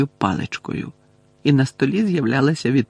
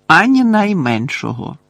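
loud, raised, emphatic denial; firm, loud follow-up; loud reply; 5 semitones higher, 5 dB louder than usual. A narrator's reading. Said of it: "голосно відповів Гаррі". (голосно, з притиском)

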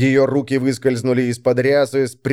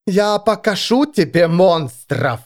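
slow, raised-pitch threat; menacing threat; 7 semitones higher, slower than usual. A narrator's reading. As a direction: promise it slowly and savagely. Vigga threatens, tearing off her robe.

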